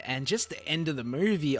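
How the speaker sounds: usual speaking voice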